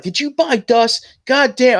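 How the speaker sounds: high, slow retold outburst; about to yell. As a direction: act out working up to a yell. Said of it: "I was getting ready to yell at you".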